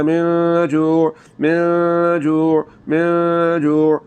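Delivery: very slow من جوع, noon held in the nose; ghunna on noon. Shown as a demonstration. Teacher shows students ghunna in من جوع, slow and nasal. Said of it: "آواز کو ناک میں لے جا کر تھوڑا سا لمبا کرنا ہے".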